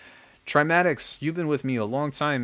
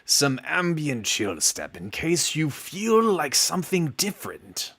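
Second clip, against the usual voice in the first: higher, slow, smooth announcer-style read; slow, higher cool-guy voice; 4 semitones higher than usual. Pretend. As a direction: lay it on like a cool radio voice. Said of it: "I'm gonna give you a cool voice".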